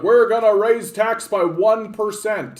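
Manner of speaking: mocking a government announcement; mock politician. Streamer mocks politicians announcing a small tax, pompous mock speech.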